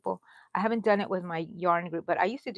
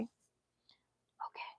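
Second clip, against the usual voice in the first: whispered okay; 19 dB quieter than usual. Real, not acted. Quiet aside after miscount.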